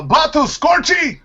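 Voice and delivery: very high-pitched Huttese game-character yell; pod racer shout. Acted. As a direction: screech it like a racing alien mid-race. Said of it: "the whole thing is shouted".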